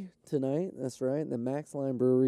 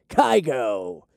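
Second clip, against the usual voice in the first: louder, hammed-up station ID shout; playful radio jingle voice; mock radio call-out; 3 semitones lower, 8 dB louder than usual. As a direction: bark the call sign like a radio DJ. Reading billboard call letters aloud.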